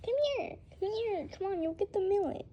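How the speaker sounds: high, quick coaxing call; coaxing pet voice. Real, not acted. Owner coaxing her bird over with millet, high and quick. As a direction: lure him over sweetly.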